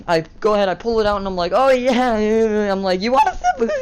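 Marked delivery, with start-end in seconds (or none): 1.5-2.7 loud, higher gloating yeahs; hammed-up triumphant yeah; cocky yeah. Gloating at the seventh grader. 3.0-3.7 very high, teasing squeak; cartoonishly high taunt; mocking offer. Taunting the kid with the beer.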